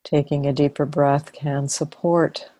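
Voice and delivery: calm, unhurried body-scan voice with long pauses; guided meditation. Real, not acted. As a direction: ease them into relaxing, phrase by phrase. Leads a body-scan relaxation.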